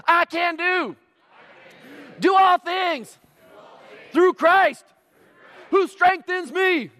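high, loud rally call; pumped-up athlete's call-and-response; imitated gladiator athlete. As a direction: lead the crowd in a hammed-up chant. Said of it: "he talked like this".

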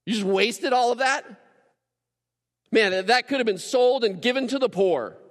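loud, high-pitched mock outrage; hammed-up appalled protest; indignant disgust. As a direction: open with a disgusted ugh, then scold her. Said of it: "guttural response to this woman".

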